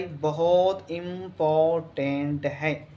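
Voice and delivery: "बहुत important" drawn out very slowly, sound by sound; slow emphatic repeat. Real, not acted. Teacher warning students about a key question, slow and emphatic. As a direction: stretch every syllable of "important" for weight.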